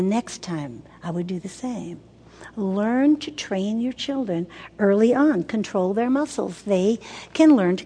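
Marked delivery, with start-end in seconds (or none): none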